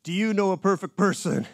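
single out the man in the back; preacher pointing out a listener loudly; calling out. Retold or imitated. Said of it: "And he goes".

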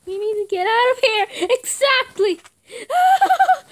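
high, loud screams; frightened screams. Real, not acted. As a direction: scream in fear. Screams during attack.

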